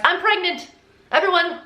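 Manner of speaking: high, hammed-up announcement; mock announcement. Liar telling all her friends, mock announcement. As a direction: proclaim it high, mocking a drama seeker.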